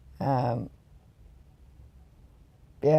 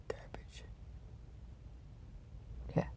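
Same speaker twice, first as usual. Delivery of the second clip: whisper; 25 dB quieter than usual. Real, not acted. Echoing feeling like garbage.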